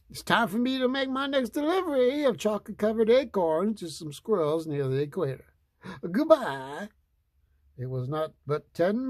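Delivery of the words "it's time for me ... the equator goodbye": high, chirpy delivery man; perky little courier voice, pitched well up; a small delivery man, voiced higher. A narrator's reading.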